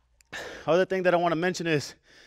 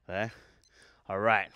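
ordinary delivery

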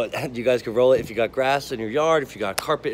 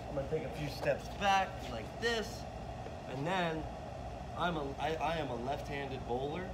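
distant from the mic, much softer; far away; 3 semitones higher, 10 dB quieter than usual. Real, not acted. Coach demonstrating bowling from down the lane, far off. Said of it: "that was a little bit far away".

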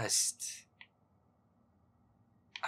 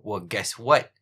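much louder, snapping back; loud outburst; 2 semitones lower, 15 dB louder, slower than usual. Real, not acted. Gamer yelling at his friend over the game. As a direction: shout it at the friend.

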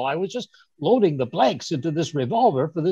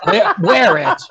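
higher, louder, disbelieving repeat; incredulous; 7 semitones higher, 8 dB louder, slower than usual. Acted. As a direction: Watson is told to wear the crown, and he echoes it in loud disbelief. Watson told to wear crown.

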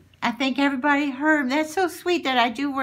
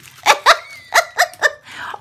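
loud high laugh; 8 dB louder than usual. Real, not acted. Laughs about baby Twix.

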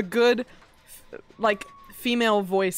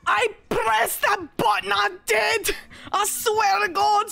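high protesting shout; 10 semitones higher, slower than usual. Real, not acted. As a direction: swear you did it, shouting. Protesting innocence in game.

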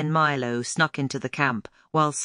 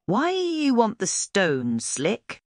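feigned curiosity; 6 semitones higher, slower than usual. A narrator's reading. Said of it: "feigning curiosity".

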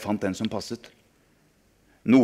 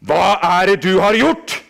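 furious demand; 9 semitones higher, 10 dB louder than usual. Acted. Adult confronts boy angrily.

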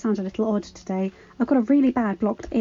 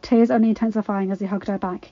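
ordinary delivery

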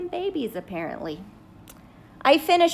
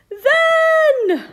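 much higher and loud, strained squeal on then; panicked; 7 dB louder than usual. Acted. Seed character panics over what happens next, squealing. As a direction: let the fear break through on then.

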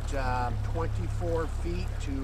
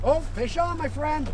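excited calling out; 9 semitones higher, 4 dB louder than usual. Real, not acted. Calling out a hooked fish.

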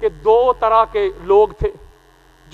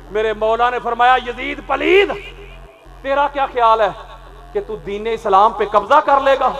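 raised, ringing sermon delivery at full force; fervent preaching; 2 semitones higher, 4 dB louder than usual. Acted. Preacher rousing the mourners about the Karbala story, fervent preaching. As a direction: preach it out to the hall, rising and driving.

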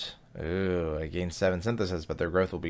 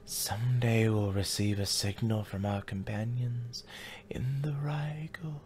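whispered reading; 3 semitones higher, 6 dB quieter than usual. Acted. Reads game text whispered.

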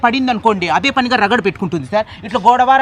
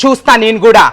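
ordinary talk

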